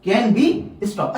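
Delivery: can be stop read very slowly word by word. Shown as a demonstration. Teacher reading the textbook line aloud to students, very slowly. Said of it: "इसको stop कर सकते हैं".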